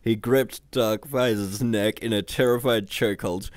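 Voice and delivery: ordinary delivery, nothing marked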